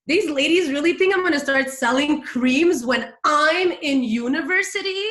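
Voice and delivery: incredulous scoff from a student pitched on selling creams; mock disbelief. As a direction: replay her scornful inner voice, indignant and amused. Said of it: "I was like".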